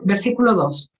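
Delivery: ordinary talk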